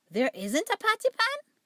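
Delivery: Ribby puzzled at the missing pan, rising high; perplexed. Acted. Ribby asks Duchess in surprise, perplexed and high. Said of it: "looking perplexed".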